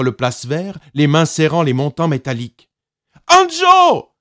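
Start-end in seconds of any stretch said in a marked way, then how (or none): 3.3-4.1 put-on high, loud call to her child; frantic mother's shout; cry out. A narrator's reading. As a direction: shout his name while running. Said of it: "cria-t-elle".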